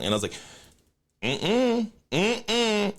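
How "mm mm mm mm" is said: high, sing-song "mm-mm"; mocking high refusal. Taunting the taco guy, mocking high refusal. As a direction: taunt him with a high no-no hum.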